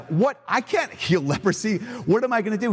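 king of Israel panicking over a leprosy request; mock panic. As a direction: act out a king losing his nerve. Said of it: "the king of Israel just freaks out".

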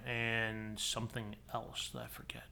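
breathy near-silent whisper. Real, not acted. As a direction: drop to a whisper for the aside. Trailing aside to co-hosts.

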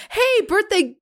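high, slow greeting with a catch in the voice; voice breaking. Retold or imitated. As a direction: let the voice crack on birthday girl. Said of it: "you could hear the break in his voice".

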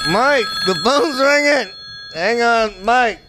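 high, urgent call to the producer. Acted. Host nags producer about the ringing phone, calling out high. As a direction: call across the studio that it needs answering.

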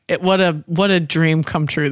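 ordinary talk

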